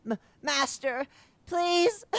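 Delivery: high, loud shrieking plea; begging creature. Acted. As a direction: shriek out please. Creature begs master.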